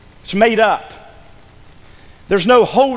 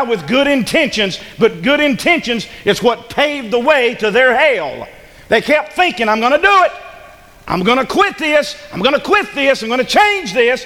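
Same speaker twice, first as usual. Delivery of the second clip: raised, urgent sermon delivery; forceful preaching; 5 semitones higher, 3 dB louder than usual. Real, not acted. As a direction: drive each phrase home to the congregation.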